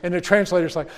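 ordinary talk, nothing marked

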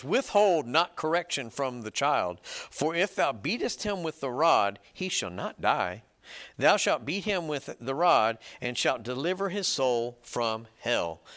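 loud, slow reading of a Bible verse; emphatic scripture reading. Acted. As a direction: proclaim the verse slowly and forcefully.

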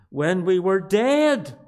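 "dead" drawn out, loud, with a falling pitch; slow down and hammer "dead"; long, forceful "dead" from the pulpit; stress on dead. Real, not acted. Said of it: "I'm emphasizing the word dead".

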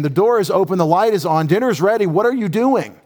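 a hint of a parent calling everyone in; God calling his people home. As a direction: call them home to dinner, urgently. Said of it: "it's God saying".

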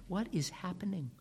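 ordinary talk

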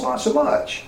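a wry, breathy throwaway; dry aside. Real, not acted. Preacher joking about jealous disciples, wry aside. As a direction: undercut it with dry humour.

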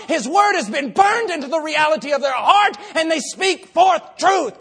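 high, thunderous shouting; full-force preaching. Real, not acted. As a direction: hammer out every phrase at the top of your voice.

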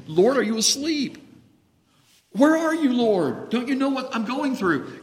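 high, anxious cry to the Lord; pleading prayer. Acted. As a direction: call out to a sleeping Lord. Preacher voicing his own prayer.

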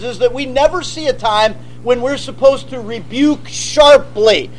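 normal talking voice

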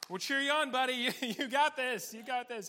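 loud, high rallying shouts; mock cheering on. Disciples egging Peter on from the boat, mock cheering. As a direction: cheer a buddy on from the sidelines.

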